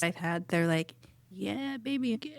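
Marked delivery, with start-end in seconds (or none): 1.3-2.3 higher-pitched mock cheering on; playful imitation of an urging partner; imitated encouragement. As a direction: egg them on in a light, high voice.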